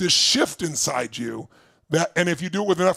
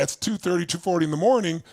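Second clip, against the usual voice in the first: usual speaking voice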